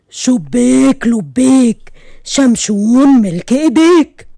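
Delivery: slow, low booming genie. Acted. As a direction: intone like a grand genie. Genie greets them again.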